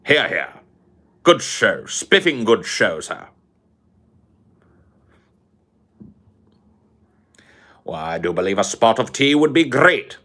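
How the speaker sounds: put-on a pompous British gentleman sneering at the colonies, posh British accent; Sargon's posh British accent. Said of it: "I can't do the accent anymore".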